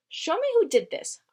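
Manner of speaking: high, loud demand; fired up, ready to fight; indignant crow. Acted. Crow Bongo demanding the culprit, high and loud. Angry crow vows revenge.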